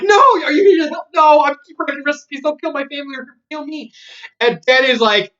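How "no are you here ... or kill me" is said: much higher, loud panicked cry; terrified pleading. Acted. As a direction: beg the intruders for mercy. Old lady begs for her life.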